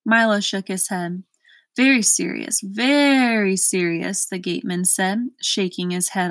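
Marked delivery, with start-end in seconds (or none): 1.8-2.6 "Very serious" at ordinary pace, grave; grave verdict. A narrator's reading. Gateman judging Milo's lack of reason, plain grave verdict. 2.6-4.2 slow, long-stretched "very"; drawn-out grave verdict. A narrator's reading. Gateman shaking his head at Milo, slow grave repeat.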